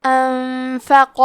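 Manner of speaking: "انفق" with the noon hidden in a hum and a heavy qaf, slow; ikhfa and heavy qaf. Shown as a demonstration. Teacher shows the nasal noon and the heavy qaf, slow. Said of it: "غنہ کے ساتھ ادا کرنا ہے".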